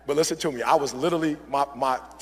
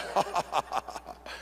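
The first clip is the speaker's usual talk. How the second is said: Bane laughing; 2 semitones lower, 9 dB quieter than usual. Retold or imitated. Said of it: "And Bane's like".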